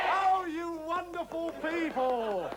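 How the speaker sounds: high, drawn-out ecstatic holler; exultant shout. Acted. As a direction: cry out to the crowd in loud triumph. Exulting at the audience's response.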